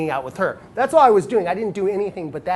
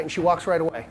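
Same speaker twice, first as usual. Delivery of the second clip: ordinary talk, nothing marked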